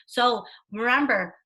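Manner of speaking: ordinary talk, nothing marked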